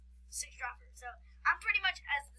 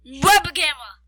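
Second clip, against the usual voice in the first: very loud blast at usual pitch; scream into microphone; 18 dB louder, slower than usual. Pretend. Kid told to scream at friend's microphone, full-volume yell. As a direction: scream it right into the mic. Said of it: "you have to scream at my microphone".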